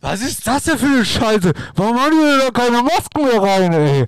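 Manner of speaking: loud, high exasperated complaint; indignant outrage. Real, not acted. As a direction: rail against it, outraged. Indignant about the masked ball.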